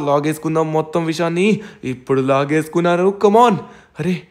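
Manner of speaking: ordinary talk, nothing marked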